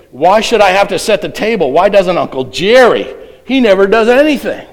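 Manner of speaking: hammed-up childish grumbling; whiny protest. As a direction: complain like an indignant kid.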